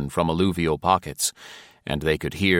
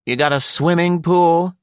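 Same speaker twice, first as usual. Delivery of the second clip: high, louder, irritated question; irritable; 11 semitones higher, 5 dB louder than usual. A narrator's reading. Tired wife asks host about a pool, irritably. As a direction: ask it irritably, pitch pushed up. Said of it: "Linda asked irritably".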